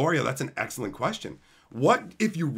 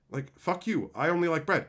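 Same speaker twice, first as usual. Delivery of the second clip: ordinary talk, nothing marked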